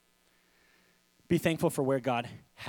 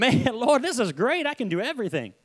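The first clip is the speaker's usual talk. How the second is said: laughing prayer; 7 semitones higher than usual. Retold or imitated. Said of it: "I went through a little season of".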